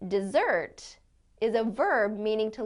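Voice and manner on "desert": "desert" stressed on the second syllable, de-ZERT. Shown as a demonstration. Teacher showing the verb, "desert" stressed at the end, de-ZERT. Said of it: "depending on the way we stress the word".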